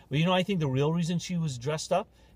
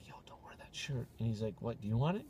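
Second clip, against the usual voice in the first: hushed retelling; 7 semitones lower, 13 dB quieter than usual. Retold or imitated. Retold dialogue hushed.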